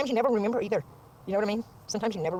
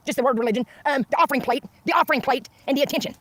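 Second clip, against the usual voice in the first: ordinary talk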